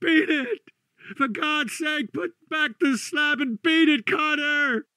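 high, desperate plea to escape; anguished, high-pitched pleading; piteous cry. Acted. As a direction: cry out piteously, begging the friend to go. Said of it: "a piteous cry from Warren".

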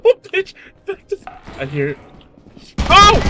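startled scream. Real, not acted. Jump scare from a monster.

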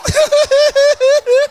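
high burst of laughter. Real, not acted. Preacher laughs at his meow joke, high and loud.

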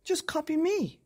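very high, eager dinosaur; excited show-off. Acted. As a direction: pipe up, proud to lead the dance. Dinosaur shows off dancing.

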